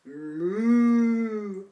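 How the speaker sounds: long, low pretend moo; slow, drawn-out cow call. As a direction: imitate a cow for children. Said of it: "It's going".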